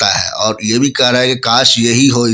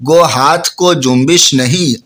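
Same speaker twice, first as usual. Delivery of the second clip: slow, higher, louder recited line; lifted, drawn-out verse reading; poem recitation; 3 semitones higher, 3 dB louder, slower than usual. Acted. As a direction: declaim it like a poet.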